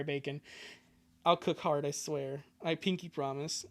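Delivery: normal talking voice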